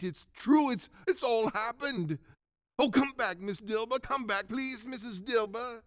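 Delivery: high, breathless joy of discovery; overjoyed Scrooge pitched right up; excited pleading. Acted. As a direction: call her back, high and urgent. Scrooge excitedly calls housekeeper back.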